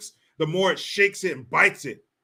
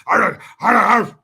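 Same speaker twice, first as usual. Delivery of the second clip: loud, high barking in your face; mock drill instructor screaming at a recruit; 6 semitones higher, 9 dB louder, slower than usual. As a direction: bark it like a boot camp drill instructor. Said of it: "your drill instructors".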